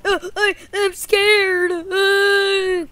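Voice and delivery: high, loud frightened whine; hammed-up fearful cry; mock panicked boy. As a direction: wail it as a scared kid. Boy figure panics in battle.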